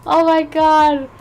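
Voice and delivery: high, loud, laughing exclamation; flustered laughter. Real, not acted. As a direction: still laughing at herself. Laughing off embarrassment.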